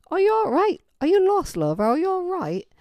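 high, loud English barmaid impression; English bartender's accent. English bartender checking on a stranger, high loud English accent. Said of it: "this big woman, she goes".

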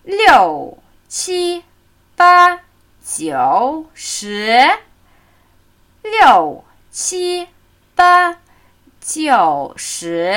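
"Liu" falls, "qi, ba" stay high, "jiu" dips, "shi" rises; falling, high, dipping, rising. Shown as a demonstration. Teacher drilling the full tone pattern, falling, high, dipping, rising. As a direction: drop "Liu", hold "qi, ba" high, squat on "jiu", lift "shi". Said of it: "Also fallende Ton, zwei hohe, hockende und aufsteigende".

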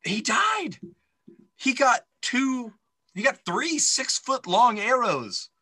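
high, loud, drawn-out disbelief; incredulous protest. Real, not acted. Cohost objecting that the character surely died, incredulous. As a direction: protest it in a high, stretched-out squeal.

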